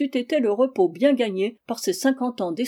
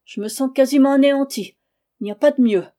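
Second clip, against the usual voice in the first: put-on louder, higher plaintive old lady voice; complaining old woman; 5 semitones higher, 7 dB louder than usual. Old servant complains to her master, loud and plaintive. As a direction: lay the misery on thick, rising into a loud lament. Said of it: "Elle répondit".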